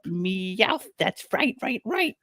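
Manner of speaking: high, hyped cat voice; Meowth voice. Retold or imitated. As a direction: pump yourself up like the cat. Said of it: "a line that Meowth would say".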